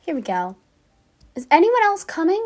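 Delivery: usual speaking voice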